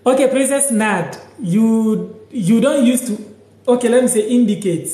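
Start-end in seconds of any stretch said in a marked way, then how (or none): none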